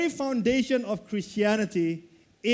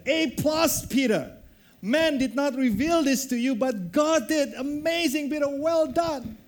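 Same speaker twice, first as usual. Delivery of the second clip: proud praise; 5 semitones higher than usual. Acted. Jesus praises Peter.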